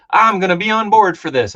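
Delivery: playfully overeager vow; mock eager. Retelling his own Transformers plan, mock eager. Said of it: "I was like".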